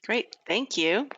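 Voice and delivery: ordinary talk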